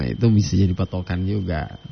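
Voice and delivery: normal talking voice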